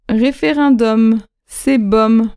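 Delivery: slow Référendum, with the M held at the end; Référendum drawn out again, final M sounded; slow and clear. Shown as a demonstration. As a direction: teach it slowly again, ending on the M. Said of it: "repeat of model".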